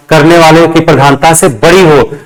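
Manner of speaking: ordinary delivery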